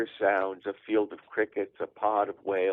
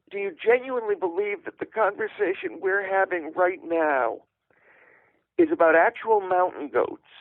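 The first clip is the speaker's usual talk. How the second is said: exasperated; 8 semitones higher than usual. Retold or imitated. Exasperated son arguing with mom.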